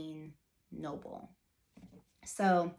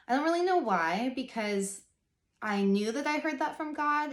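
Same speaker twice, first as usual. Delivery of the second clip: near tears; 6 semitones higher, 5 dB louder than usual. Real, not acted. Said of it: "start bawling".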